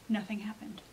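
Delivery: whispered, tense and waiting; hushed suspense; suspenseful whisper. A narrator's reading. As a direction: hold your breath and whisper it. Suspense in story.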